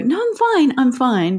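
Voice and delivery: fake-cheerful I'm fine; mock brush-off. Parent to child, a mock cheery brush-off. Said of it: "when we go".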